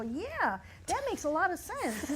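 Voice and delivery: high, sing-song mock of the crew agreeing; mock agreeable crew. Mocking the DP agreeing with the male assistant, high sing-song.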